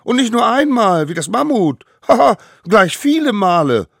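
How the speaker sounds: put-on high, loud boastful child shouting; bellowing. A narrator's reading. Smallest savage outdoes the mammoth's injury, yelling. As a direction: shout it cockily with a scoffing haha. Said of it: "brüllte der Allerkleinste".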